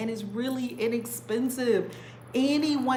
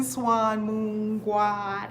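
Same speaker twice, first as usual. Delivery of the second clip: slow, stretched call to the group; drawn-out call; much slower than usual. Real, not acted. Calling out to her online community, slow and stretched.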